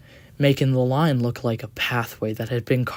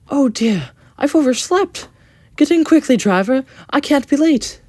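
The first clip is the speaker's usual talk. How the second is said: high, loud, hurried panic over oversleeping; a flustered young engine who fears being late; 9 dB louder than usual. Acted. Engine hurries his driver, high and loud in a fluster.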